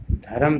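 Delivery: धर्म in two syllables, dha-ram; wrong pronunciation. Shown as a demonstration. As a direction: add a vowel after र in धर्म. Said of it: "जो कि गलत है". (धर्म को ध-रम बोलें)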